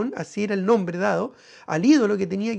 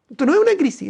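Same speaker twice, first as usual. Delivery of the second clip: forceful declaration; 8 semitones higher, 6 dB louder, faster than usual. Real, not acted. Preacher's climax.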